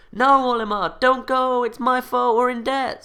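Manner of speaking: high, mock-desperate plea; pleading wife. Wife begging Olimar not to go over the debt, hammed-up pleading. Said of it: "something like that".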